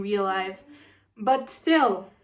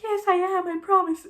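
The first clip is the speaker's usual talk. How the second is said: imitating Lena; 7 semitones higher than usual. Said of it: "Lena being like".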